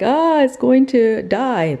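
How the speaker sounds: high, slow whiny husband voice; imitating husband. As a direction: do a lightly whiny worried husband. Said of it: "he was like".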